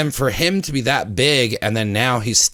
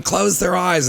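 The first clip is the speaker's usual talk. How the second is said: high, urgent mom voice; worried mother hushing the kids, pitched up; imitating mom; 7 semitones higher, slower than usual. Mom at family movie time shielding the kids, high mock voice. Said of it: "my mom's going".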